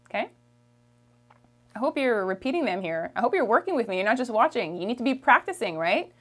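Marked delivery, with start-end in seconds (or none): none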